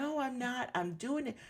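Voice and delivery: high-pitched mock protest; retold defensive denial. Wife answering her husband's accusation, defensive.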